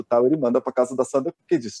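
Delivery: ordinary talk, nothing marked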